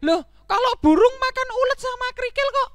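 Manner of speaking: very high, loud whining protest; squealing, indignant donkey; upset disbelief. Acted. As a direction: wail in baffled frustration. Donkey upset voice still ugly.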